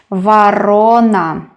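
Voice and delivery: Varona slowly with a long rolled RO; stressed middle syllable. Shown as a demonstration. Teacher repeats crow in Russian, Varona stressed on RO. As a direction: draw out "va-RO-na", falling at the end. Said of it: "The middle syllable is stressed".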